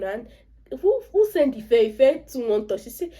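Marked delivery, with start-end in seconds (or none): 0.8-2.8 mock scolding. Acted scolding of meddling Ife.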